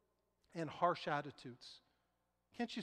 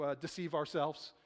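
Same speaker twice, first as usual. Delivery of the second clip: usual speaking voice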